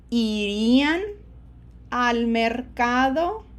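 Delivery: slow, loud Spanish phrase, carefully spaced; model pronunciation. Shown as a demonstration. Teacher models the same ending for learners, slow and emphatic. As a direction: say it loudly and deliberately for learners.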